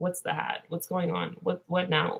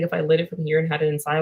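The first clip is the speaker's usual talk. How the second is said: ordinary delivery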